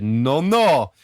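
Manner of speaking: a lightly voiced accent for the United States; mock accent. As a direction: say "No, no" in a put-on accent. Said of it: "I gave an accent to the United States".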